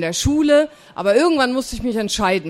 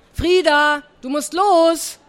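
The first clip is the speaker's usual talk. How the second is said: put-on higher, louder aunt calling a child; calling from outside; 6 semitones higher, 4 dB louder, slower than usual. Aunt calls her niece out of the cowshed, calling out. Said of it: "rief Tante Siggi draußen".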